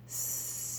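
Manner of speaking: soft long hissed "Sss"; snake hiss. Shown as a demonstration. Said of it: "slithers along and says".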